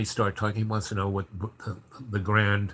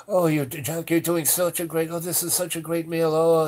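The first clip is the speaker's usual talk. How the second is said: gushing praise; 6 semitones higher than usual. Retold or imitated. Boss gushing praise at chef.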